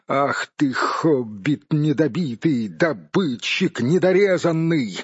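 ordinary delivery, nothing marked

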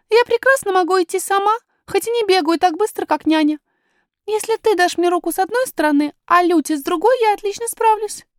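put-on very high child's voice; high-pitched, confident little princess; little princess, self-possessed. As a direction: assert yourself as a small girl.

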